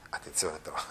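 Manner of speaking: sudden low whisper of warning. Real, not acted. Teacher warning the students in a whisper. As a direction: drop to a whisper to catch attention. Teacher warns class, aside.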